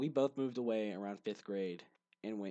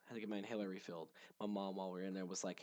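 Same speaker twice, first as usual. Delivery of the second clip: ordinary talk, nothing marked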